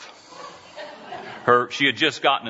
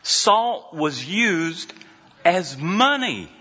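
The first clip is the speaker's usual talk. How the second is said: raised, emphatic sermon delivery; preaching; 6 semitones higher than usual. Acted. Pastor preaches to congregation about salt, raised and emphatic. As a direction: lift it and preach the worth of salt.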